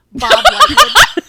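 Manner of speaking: laughing. Real, not acted. Laughing at silly name.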